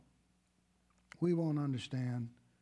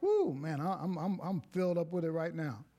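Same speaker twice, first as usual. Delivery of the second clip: ordinary talk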